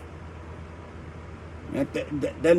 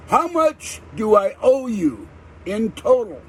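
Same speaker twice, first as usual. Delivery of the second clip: slow, high, word-by-word question; exasperated demand; 6 semitones higher, slower than usual. Retold or imitated. Customer asks the agent for the fifth time, exasperated. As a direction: spell it out with drawn-out impatience.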